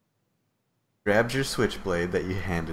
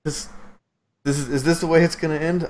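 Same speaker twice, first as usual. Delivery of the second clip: despairing NPC voice; 5 semitones higher than usual. Acted.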